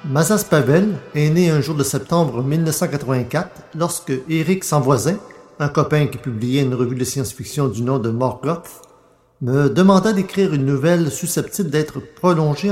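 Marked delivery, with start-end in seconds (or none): none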